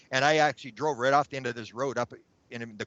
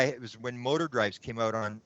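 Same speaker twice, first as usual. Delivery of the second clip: normal talking voice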